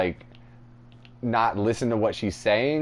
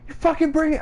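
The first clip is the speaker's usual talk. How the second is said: very high mock-angry screech; embellished mom yell; 4 dB louder, faster than usual. Friend embellishes mom's tirade at Twig, mock fury. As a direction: start her exaggerated high-pitched tirade. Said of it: "I'm embellishing".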